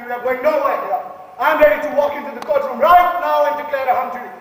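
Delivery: loud, pushy rallying cry; shouting. Acted. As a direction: shout down the room to win votes. Juror demands a hung jury.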